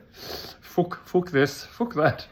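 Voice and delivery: put-on quick Irish accent with a laugh on the first Fuck; mock Irish swearing. Mimicking Irish friends who swear fast, Irish accent. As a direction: fling out the curses in a playful Irish lilt. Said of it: "I had to give it a wee bit of Irish".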